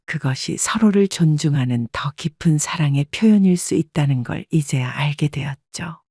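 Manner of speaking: ordinary delivery, nothing marked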